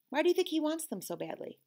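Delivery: plain reading voice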